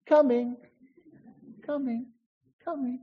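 high little voices answering, fading out; little echoing voices. Acted. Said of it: "little voices come back".

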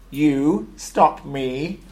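loud, very slow incredulous roar; roaring tank. A narrator's reading. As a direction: draw it out like a scornful battle tank. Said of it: "roared the tank".

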